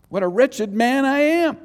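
loud, high wretched outcry; wretched lament. Acted. Preacher acting out Paul feeling crummy, wretched lament. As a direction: cry out in dismay at yourself. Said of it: "He's feeling pretty crummy about that".

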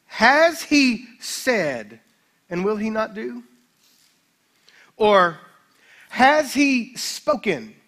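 slow, swelling reading of the verse; weighty scripture reading. Acted. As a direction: pastor reading Numbers to the church, slow and weighty. Reads Numbers verse aloud.

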